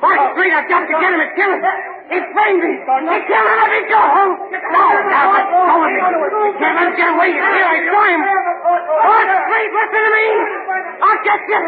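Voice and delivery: wild threats shouted at his enemy; frantic shouting. Acted. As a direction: scream threats while struggling against the guards. Prisoner raving as guards hold him.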